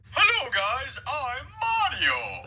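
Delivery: high voice-changer effect. Shown as a demonstration. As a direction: run it through a voice app, high and slow. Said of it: "so I got my video right here".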